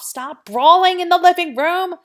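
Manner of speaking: put-on loud, high yell at the kids; parent yelling. Parent yelling at fighting kids, loud high yell.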